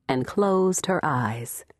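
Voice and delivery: ordinary delivery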